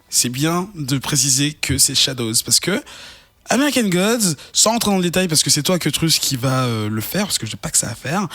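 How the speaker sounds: usual speaking voice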